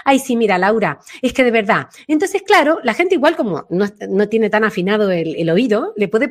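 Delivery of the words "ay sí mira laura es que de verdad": usual speaking voice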